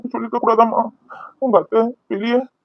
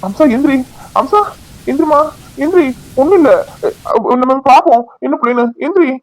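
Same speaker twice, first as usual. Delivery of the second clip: urgent, loud and high calls; calling out; 6 semitones higher, 4 dB louder than usual. Acted. Husband urgently calling his fainted wife to get up. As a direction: shake her awake with your voice.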